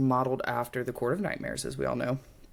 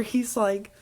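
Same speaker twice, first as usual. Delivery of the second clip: ordinary talk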